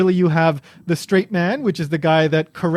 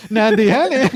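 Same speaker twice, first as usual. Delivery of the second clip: higher, louder "Nande ya nen" through laughter; amused, raised-voice comeback; laughing mock retort; 5 semitones higher, 5 dB louder, slower than usual. Shown as a demonstration. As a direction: crack up while throwing out the comeback. Laughing along at co-host's joke version.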